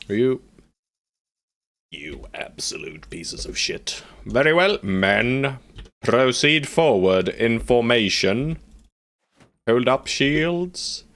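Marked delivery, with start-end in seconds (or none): 1.9-4.0 slow, whispered insult; hushed furious swear; whispered curse. Real, not acted. Cursing at the enemy. 4.3-11.0 higher, slow ringing orders; lightly voiced officer giving orders; commanding officer.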